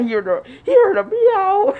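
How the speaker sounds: laugh-crying. Real, not acted.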